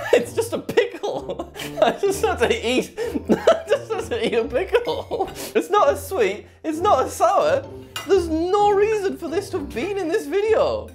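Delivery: high, laughing disbelief. Real, not acted. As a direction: laugh it out, squeaky and incredulous. Laughing in disbelief after pickle.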